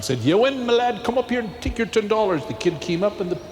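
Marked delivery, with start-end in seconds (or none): 0.2-2.4 pleased Irish priest, a light brogue, pitched up; Irish accent. Retold or imitated. Teacher fresh from Ireland rewarding the kid, light Irish accent. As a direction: hand over the prize in a light Irish lilt. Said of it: "fresh from Ireland".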